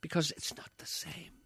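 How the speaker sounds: hushed, breathy whisper of a secret. Real, not acted. Whispering to his co-host that it's not the same. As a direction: lean in and whisper it.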